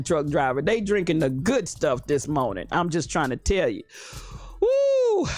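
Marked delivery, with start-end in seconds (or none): 4.6-5.3 a sudden high whoop of delight; excited whoop. Real, not acted. Host whooping at her own wine joke, high excited cry. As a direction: let out a joyful holler.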